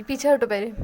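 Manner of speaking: पीछे हटो snapped out loud; loud annoyed order to move back. Acted. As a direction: bark पीछे हटो at her. Annoyed girl pushing friend off.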